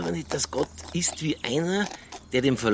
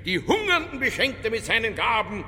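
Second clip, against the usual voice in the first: declaiming prayer; 7 semitones higher than usual. Acted. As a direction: proclaim the Magnificat defiantly. Detective declaims the Magnificat. (pathetisches Deklamieren)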